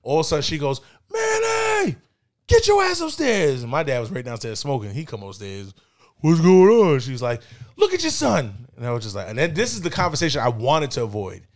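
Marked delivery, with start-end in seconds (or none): 1.1-3.6 very high, slow, drawn-out holler; mom yelling. Retold or imitated. Mom summons dad from downstairs, shrieked mock yell. 6.2-7.0 loud, raised-pitch question; dad calling out. Retold or imitated. Dad comes upstairs to see the trouble, loudly asking. Dad arrives after being yelled for. 7.8-8.7 high-pitched scolding; accusing mom. Retold or imitated. Mom shows dad their high son, outraged.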